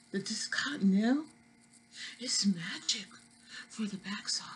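hushed secret whisper; conspiratorial whisper about the toilet paper. Acted. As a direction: let them in on a secret, whispered. Confiding aside to viewers.

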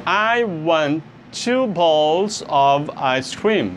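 very slow, careful reading; slow reading of the example. Shown as a demonstration. Teacher reading the last example sentence slowly. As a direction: read the sentence out word by word. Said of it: "Number ten, a última".

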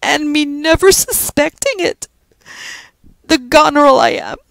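high, loud Irish wail. A narrator's reading. Judy blaming herself for missing Joe's plan, wailing. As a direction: wail it out in self-reproach. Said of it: "wailed Judy".

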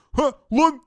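high, loud sudden exclamation; startled shout. Real, not acted. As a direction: yelp at the discovery. Spots the hidden passage. (ani şaşkın çığlık)

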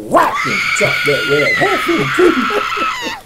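telling how the coach chopped the rat; excited telling. Real, not acted. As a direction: relish the big chop. Telling the chop.

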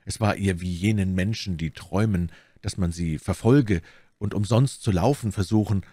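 ordinary delivery, nothing marked

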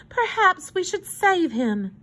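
slow, high-voiced concern; pitying fine lady. A narrator's reading. Woman in fur coat considers rescuing hobo, pityingly. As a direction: suggest it slowly and piteously. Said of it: "said the woman in the brown fur coat".

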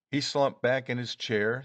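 ordinary delivery

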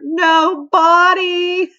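high, drawn-out howl breaking into laughs; howling with laughter. A narrator's reading. Lenny howls at Mister Jupiter's joke, laughing. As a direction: stretch it out high, then burst out laughing. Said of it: "howled Lenny".

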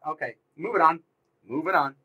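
usual speaking voice

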